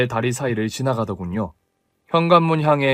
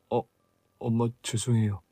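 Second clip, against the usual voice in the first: much softer, meek apology; 3 semitones lower, 9 dB quieter than usual. Acted. Son meekly apologizes to his angry mother, very soft. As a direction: barely get the sorry out.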